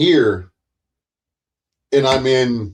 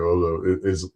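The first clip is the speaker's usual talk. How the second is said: mock boring talker; 7 semitones lower, 7 dB quieter than usual. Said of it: "you talk like this".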